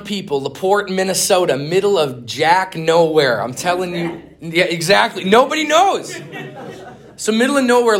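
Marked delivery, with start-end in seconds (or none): 5.2-8.0 high, loud laughing words. Real, not acted. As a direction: laugh through it at the tiny town. Laughing at the tiny town.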